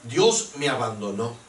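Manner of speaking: hushed imitated despair. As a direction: let it sink low, then lift at the end like a doubt.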